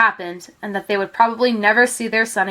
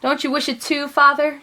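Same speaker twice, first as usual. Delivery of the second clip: sad plea; 5 semitones higher than usual. A narrator's reading. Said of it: "she said".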